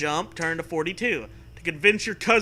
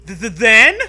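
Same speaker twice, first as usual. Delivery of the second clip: loud nervous stammer on the first word; stutter; 5 semitones higher, 12 dB louder than usual. Acted. Narrator playing you stutters to Zoe in fear. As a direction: trip over the start of "then", louder. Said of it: "you stutter".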